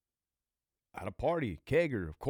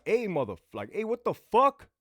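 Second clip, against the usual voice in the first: retold angry yell at the cop, pitch up and louder; 11 semitones higher, 7 dB louder than usual. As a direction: shout it back like you've just been shoved. Cop pushes him against wall.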